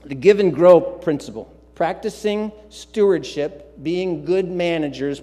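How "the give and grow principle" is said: preaching emphasis. Acted. Preaching point.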